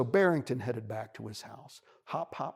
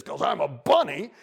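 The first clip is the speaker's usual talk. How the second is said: loud, high wailing complaint; crying bunny; 5 semitones higher, 11 dB louder than usual. Acted. Bunny wails to the wolf, loud and tearful. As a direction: bawl it out like a hurt child. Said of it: "he starts crying again".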